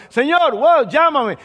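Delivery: an adventurer begging to jump in; a delighted high-pitched plea; excited Peter. Acted. Peter eager to walk on water.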